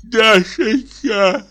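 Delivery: poisoned man tearfully calling Dashenka; tearful plea. A narrator's reading. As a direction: drag out each word like a sob. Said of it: "сказал он плачущим голосом".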